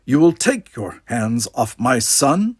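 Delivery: loud and slow, anger held in check; every word a warning to the man holding his son; controlled anger. Acted. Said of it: "deadly whiplash of controlled anger".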